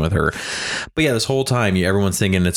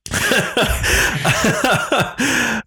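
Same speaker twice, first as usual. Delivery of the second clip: long laugh. Real, not acted. Laughing at own remark.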